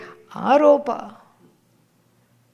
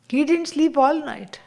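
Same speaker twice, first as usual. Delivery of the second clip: ordinary talk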